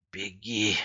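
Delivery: understated husky last-gasp order; hoarse croak. A narrator's reading. Gleb urges his friend to flee, croaking hoarsely. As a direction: croak it with the last of your strength. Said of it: "прохрипел он осипшим голосом".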